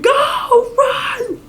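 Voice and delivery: high, frantic yell at the screen; screaming at the movies. Retold or imitated. A parent yells at the screen as a hero runs. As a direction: shout a warning to a movie character. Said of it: "you're screaming at the movies".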